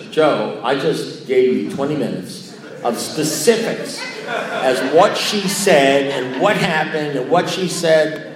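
slow, heavily emphatic protest; exasperated retort. Retold or imitated. Answering the sponsor who missed twenty minutes of specifics, exasperated. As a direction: spell it out to him in exasperation, drawn out.

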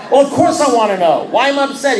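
high, loud, fed-up retort; exasperated demand. Retold or imitated. Member demanding the answer from sponsor, exasperated.

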